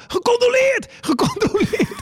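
hoge, langgerekte roep van een toeschouwer; nageroepen uit de zaal. Retold or imitated. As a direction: roep het heel hoog, als publiek van achteruit. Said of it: "begonnen mensen te schreeuwen uit de zaal". (schreeuwend uit de zaal)

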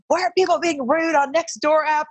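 high-pitched complaint, acting out own outburst; mock griping. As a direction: whine about the neighbors, playfully exaggerated. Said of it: "bitching about somebody on the Nextdoor app".

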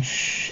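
soft, drawn-out shush; hushing shh. Acted. Someone calming the whimpering Mercy, hushing softly. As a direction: hush it gently.

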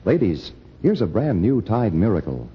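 ordinary talk

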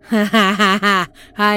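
put-on loud crazed old woman cackle; mad cackle. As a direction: cackle like a madwoman.

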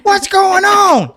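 high, drawn-out holler; alarmed shout. Retold or imitated. Friend running up to the roadside emergency, shouting in alarm.

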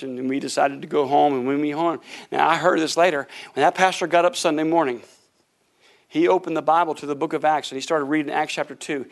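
usual speaking voice

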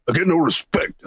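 grumbling comic catchphrase in a put-on voice; Rodney Dangerfield. Reminding a co-host who the comedian is, put-upon catchphrase impression. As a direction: toss out a put-upon old comedian's complaint. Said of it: "Oh, yeah, Rodney Dangerfield".

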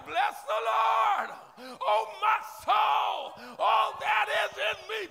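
high, loud, slow crying-out praise; full-force praise prayer. Acted. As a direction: pour it out to God at full voice.

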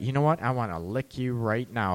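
low, soft bedroom murmur; sultry offer. Retold or imitated. Voicing a man's bedroom offer.

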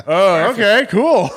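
fake-surprised acceptance; mock surprise. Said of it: "I'm like".

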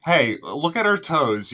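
ordinary talk, nothing marked

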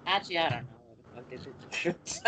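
fast muttered aside; quick quiet aside. Real, not acted. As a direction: rush it under your breath.

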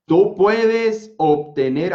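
very slow, every word stretched; slow motion. Shown as a demonstration. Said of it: "hasta en cámara lenta te lo voy a decir". (en cámara lenta)

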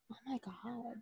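breathless whispered gasp; hammed-up scandalized whisper; mock whisper. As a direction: whisper it like gossiping at ringside. Commentators gasping in whisper.

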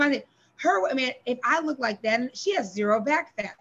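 ordinary talk

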